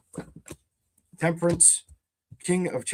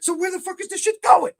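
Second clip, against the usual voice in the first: much higher, louder exasperated yell; frustrated shout; 5 dB louder than usual. Real, not acted. Reader yelling at the spread in frustration. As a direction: demand an answer from the cards.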